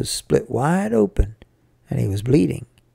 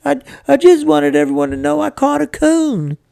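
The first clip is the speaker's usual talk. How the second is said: high, stammering tearful protest; sniffling boy; 11 semitones higher, 4 dB louder than usual. A narrator's reading. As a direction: whine your excuse through tears. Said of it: "I sniffled".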